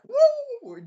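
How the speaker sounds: very high, loud, long "woo"; comic leaping whoop. Retold or imitated. Hero jumping with the girl, high comic whoop.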